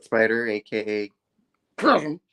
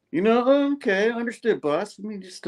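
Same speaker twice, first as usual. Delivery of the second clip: mock Chasm voice; 9 semitones higher than usual. Chasm yielding to the boss, mock placating voice. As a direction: feign backing down, voice dropping away. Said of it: "Chasm's all like".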